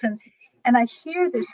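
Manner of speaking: ordinary talk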